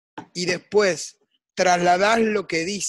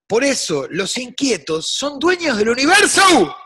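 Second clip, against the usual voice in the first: higher, louder proclamation; emphatic declaration; 8 semitones higher, 7 dB louder than usual. Real, not acted. Guest ends his talk with a loud motto. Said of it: "final motto".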